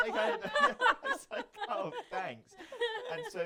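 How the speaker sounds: high, loud words through laughter; laughing. Real, not acted. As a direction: talk while cracking up. Teller cracks up at own story.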